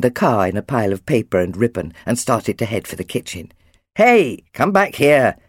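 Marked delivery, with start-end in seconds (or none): 3.9-5.4 louder, slow, mildly cross call; dad calling a child back, drawn out; a little cross. A narrator's reading. Dad calls Jack back from the kitchen, a little crossly. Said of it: "Dad called a little crossly".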